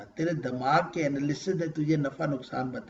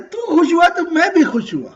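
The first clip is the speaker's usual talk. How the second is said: joyful exclamation, loud and high with laughter; 11 semitones higher, 10 dB louder than usual. Acted. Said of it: "اور پھر اللہ کہتا ہے".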